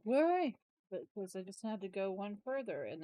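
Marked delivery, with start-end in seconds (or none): 0.0-0.5 incredulous. Retold or imitated. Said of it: "I'm like".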